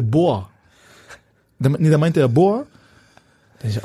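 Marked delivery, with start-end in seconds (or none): none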